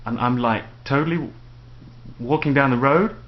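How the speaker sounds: mock uptalk, ending "road" on a questioning rise; upward inflection. Shown as a demonstration. Imitating the local overuse of like, with "road" rising like a question. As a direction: say a statement but lift "road" as if asking. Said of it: "the upward inflection".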